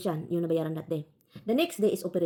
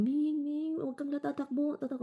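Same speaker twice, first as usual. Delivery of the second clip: higher, softer pleading coax; coaxing the cat; 7 semitones higher, 3 dB quieter, slower than usual. Retold or imitated. Pleading with the cat Ming not to run away, coaxing softly. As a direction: gently beg the cat to stay.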